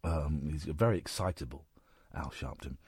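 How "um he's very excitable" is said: usual speaking voice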